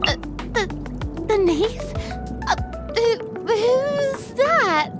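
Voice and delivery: nervous, high-pitched stammering; flustered stammer. Acted. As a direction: stumble over it in a high, rattled voice. Flustered feigning ignorance of Knave.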